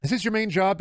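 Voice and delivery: lightly mimicked viewer, pitched well up; mock viewer question. Streamer mimicking viewer asking about his job, mock high-pitched question. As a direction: put on a high, nosy questioner.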